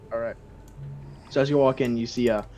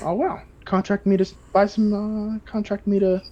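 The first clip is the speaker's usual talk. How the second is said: higher, sly sales pitch; higher wink-wink huckster; scheming merchant; 8 semitones higher than usual. Acted. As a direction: angle for a deal. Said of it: "He says".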